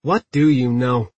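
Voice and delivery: usual speaking voice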